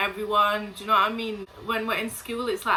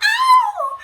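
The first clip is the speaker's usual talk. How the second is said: very high, loud squealed "Ow"; mock yelp of pain; 11 dB louder than usual. Imitating the girl getting hit, squealed yelp. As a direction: yelp like you've just been hit. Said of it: "and she's like".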